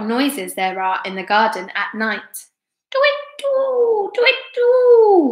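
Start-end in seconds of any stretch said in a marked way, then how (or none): 2.9-5.3 very high, piping owl hoot. A narrator's reading. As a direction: hoot twice, swelling louder. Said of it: "went the owl".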